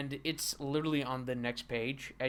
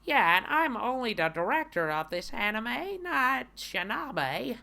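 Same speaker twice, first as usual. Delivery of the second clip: director character voice; 9 semitones higher, 4 dB louder than usual. Acted. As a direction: whine it upward, stretching the end.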